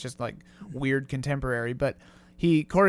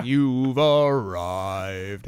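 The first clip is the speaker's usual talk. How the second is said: much lower, slow mushroom voice; really low voice; 7 semitones lower, much slower than usual. Retold or imitated. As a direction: drop deep and drag it out. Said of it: "another one really low".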